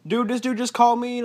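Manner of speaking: high, excited call-in voice. Retold or imitated. Guest imitating a caller to the radio show, excited caller voice.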